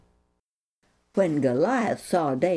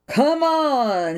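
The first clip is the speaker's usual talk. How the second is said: louder, higher call; roaring giant; 8 semitones higher, 5 dB louder than usual. A narrator's reading. Giant calling to Israel's army, a mild roar. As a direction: bellow it at the trenches. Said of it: "he roared".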